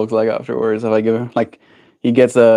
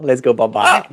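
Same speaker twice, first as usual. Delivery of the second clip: usual speaking voice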